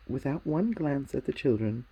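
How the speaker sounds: usual speaking voice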